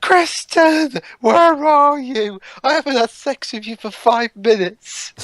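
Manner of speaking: high, pleading mock lover; calling for Kristen. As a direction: wail her name, hammed up. Mocking a movie character calling.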